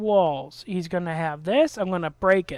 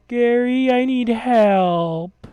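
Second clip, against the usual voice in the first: drawn-out, pleading mock whine of a helpless friend; whiny mock plea; 3 semitones higher, 5 dB louder, much slower than usual. Mocking a gamer friend who suddenly begs Gary for help, slow whiny plea. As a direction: stretch it out like a needy buddy.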